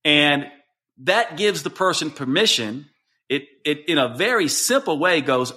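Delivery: ordinary talk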